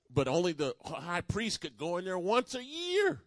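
high, loud, rising sermon delivery; preaching at full force. Acted. Preacher telling the church about the high priest, loud and high. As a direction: build the Holy of Holies story to a ringing peak.

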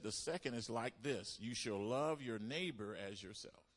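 loud, measured scripture proclamation; preached scripture quote. Real, not acted. Preacher reading Jesus's words to the church, loud proclamation. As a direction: proclaim each line like a verdict.

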